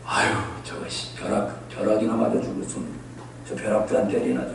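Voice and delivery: low, hushed grumbling under the breath; muttered cursing. Acted. Person wishing lightning on someone they hate, muttered cursing. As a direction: mutter a secret curse, half whispered. Voicing inner hatred of enemies.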